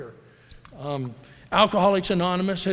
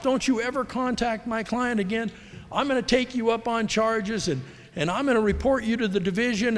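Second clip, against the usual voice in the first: much higher, mock ranting tirade; imitating screaming agent; 6 semitones higher than usual. Retelling a furious realtor's phone rant, mock shrill fury. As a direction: play her raving at him, half-mocking. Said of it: "she just went off".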